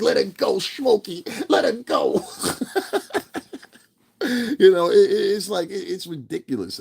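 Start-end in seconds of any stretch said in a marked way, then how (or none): 0.0-2.2 high, loud, half-whispered plea; mock pleading shout. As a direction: yell at Smokey to let her go. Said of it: "And you're like".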